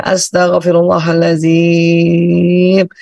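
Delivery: very slow, drawn-out recitation; full istighfar. Shown as a demonstration. Showing listeners how istighfar releases breath, full drawn-out recitation. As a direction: hold the last syllable long and steady. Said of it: "yang penuh supaya pas waktu dilafazkan".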